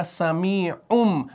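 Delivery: समीउम drawn out, ending on m; iqlab demo. Shown as a demonstration. Teacher shows what tanween becomes, m ending समीउम. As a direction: close समीउम slowly on the lips with m. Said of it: "तो ये बनेगा".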